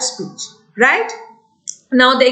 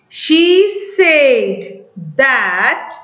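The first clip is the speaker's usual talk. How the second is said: slow dictation; much slower than usual. Shown as a demonstration. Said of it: "your friend is narrating".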